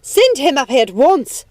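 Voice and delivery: loud, high furious command; screamed order. A narrator's reading. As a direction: scream it at the servant. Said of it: "I screamed".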